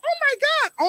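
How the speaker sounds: high excited cry; excited squeal. Real, not acted. Excited about free show.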